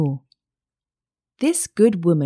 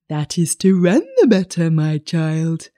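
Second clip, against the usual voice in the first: lightly voiced wolf, reassuring; a hint of disguised creature, falling at the end; wolf as grandmother. A narrator's reading. Wolf explaining himself to the child, mild wolf voice.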